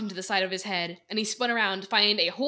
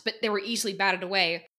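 ordinary delivery

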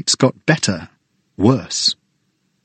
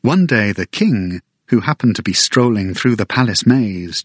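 ordinary delivery, nothing marked

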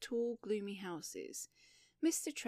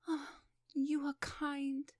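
moved to sob; 6 semitones higher, slower than usual. Acted. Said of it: "moved to sob".

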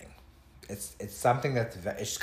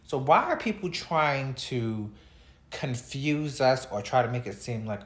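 ordinary delivery

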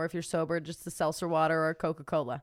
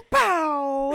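loud high pow like a thunderclap; thunder crack; 6 semitones higher, 7 dB louder than usual. Pretend. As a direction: crack it out like lightning. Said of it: "how do we do thunder?".

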